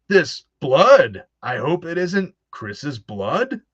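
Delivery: slow mock read with odd emphasis on "blood"; weird inflections. Mocking the old game's voice acting, slow odd inflections. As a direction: overact the line with strange stress. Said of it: "why are there so many weird inflections".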